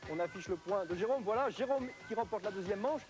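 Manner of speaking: high, excited game commentary; live play-by-play. Acted. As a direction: call the race as it happens, climbing.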